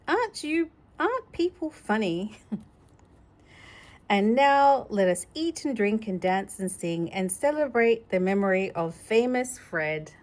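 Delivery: plain reading voice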